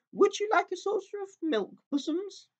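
high, sugary mock baby talk; jeering taunt. A narrator's reading. Creatures mocking Aslan as a cat, high sugary sneer. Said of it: "jeering at him".

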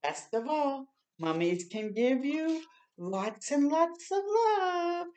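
ordinary delivery

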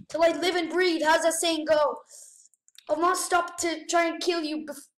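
ordinary delivery

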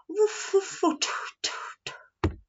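high puffs fading to airy toots; whispery chuffs and toots, trailing away; train noises. A narrator's reading. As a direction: imitate a departing steam train. Imitates the train departing.

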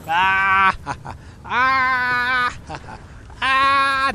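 loud startled yells; frustrated yelling. Real, not acted. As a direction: yell out in frustration. Shot while gaming, yelling.

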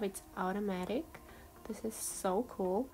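ordinary talk

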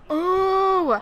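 loud, high, drawn-out "oh"; frustrated cry. Real, not acted. Frustrated outburst during the game.

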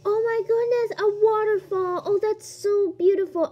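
gasp, then high gushing wonder; awed delight. Real, not acted. Player discovering a beautiful waterfall, delighted wonder.

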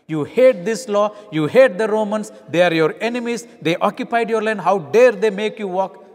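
loud, bitter, rising indignation; angry. Acted. Preacher voicing the first miler's resentment of Roman law, loud and angry. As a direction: stoke the hatred of the occupier. Voicing the farmer's inner anger.

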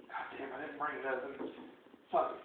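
low, hushed self-talk; muttered retelling of giving up; grumbling to himself. Retold or imitated. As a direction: grumble it under your breath.

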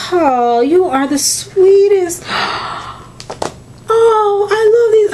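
high, rising squeals of delight over a gift; gasp, then squeal with pure joy. Real, not acted. Opens a gift from Joan.

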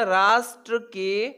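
"राष्ट्र के" drawn out at half speed; slow dictation. Shown as a demonstration. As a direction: stretch "राष्ट्र के" out slowly for students. Teacher repeats title slowly for students. ("राष्ट्र के" को धीरे-धीरे खींचकर बोलें)

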